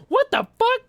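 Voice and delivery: high squeaky shocked "what the fuck"; shocked waitress. Retold or imitated. Waitress stunned by a ten grand tip, high shocked squeak. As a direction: yelp it out in disbelief.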